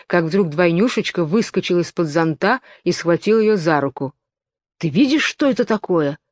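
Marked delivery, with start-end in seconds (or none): none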